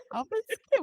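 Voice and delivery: high-pitched giggly echo; laughing. Real, not acted. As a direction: repeat the joke through laughter. Echoing the joke while laughing.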